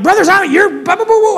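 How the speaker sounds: high-pitched, blurted interruption; impatient outburst. Acted. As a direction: jump right in, tumbling into blah-blah. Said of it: "would have jumped right to it and been like".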